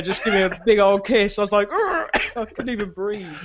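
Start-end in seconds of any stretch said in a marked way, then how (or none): 1.8-2.4 high-pitched disgusted groan; disgusted urgh. Retold or imitated. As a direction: squeal out a grossed-out urgh. Kissed out of nowhere, disgusted.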